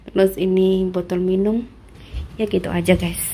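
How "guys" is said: ordinary delivery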